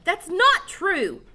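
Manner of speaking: loud, high and very slow; yelling. A narrator's reading. Joe comes running back yelling at the boys. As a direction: drag out the yell.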